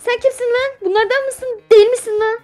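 high mock interrogation; hammed-up crazed questioning; wild unhinged ranting. Interrogating a game character. (çılgınca bağıran sorgu)

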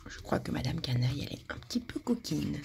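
put-on confiding whisper to the children. A narrator's reading. Storyteller whispering to children about the rascal. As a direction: lean in and whisper the secret.

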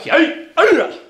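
very high, loud karate yell; full-force kiai; kiai shout. Real, not acted. Instructor ending spear hand drill, kiai shout.

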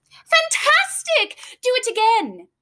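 very high, slow, thrilled cry; excited shout. A narrator's reading. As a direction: cheer him on to go again. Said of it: "Elizabeth shouted".

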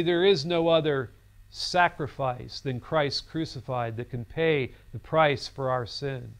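normal talking voice

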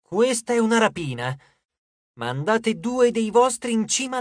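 slow, deliberate reading of demands; emphatic, measured message reading; reading aloud. A narrator's reading. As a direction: read out a robber's note, each order weighted. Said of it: "Lei lesse ad alta voce il messaggio".

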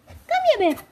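a high coaxing call to the dog. Real, not acted. Calling the dog.

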